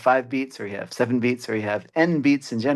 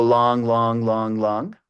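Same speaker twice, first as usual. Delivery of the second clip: drawn-out long syllables; much slower than usual. Shown as a demonstration. Lecturer showing eight beats made of longs, drawn-out and evenly counted. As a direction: chant each LONG held for two even beats. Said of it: "long syllables are actually pronounced for a longer duration".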